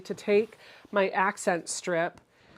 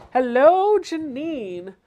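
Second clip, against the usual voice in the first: bright, high hello to a friend; excited greeting; 6 semitones higher, 5 dB louder, slower than usual. Real, not acted. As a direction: call out a cheery welcome. Greeting a viewer in chat.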